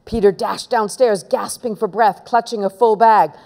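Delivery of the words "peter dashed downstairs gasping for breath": ordinary delivery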